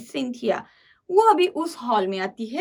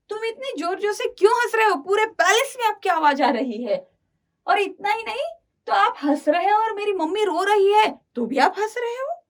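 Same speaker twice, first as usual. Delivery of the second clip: higher-pitched, insistent questioning; retold daughter's mild protest to her father; troubled child; 7 semitones higher than usual. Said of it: "अभी ये बच्ची भी परेशान है". (परेशान बच्ची की हल्की शिकायत)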